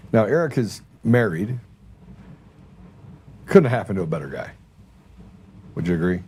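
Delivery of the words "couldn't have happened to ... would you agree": dry, low-pitched sarcasm; sarcastic. Real, not acted. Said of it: "I sense a large amount of sarcasm".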